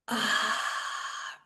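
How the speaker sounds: long audible sigh as the breath goes out; modelling a slow release for the listener; deep exhale. Shown as a demonstration. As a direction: let the held breath go with a sigh. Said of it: "breathe out".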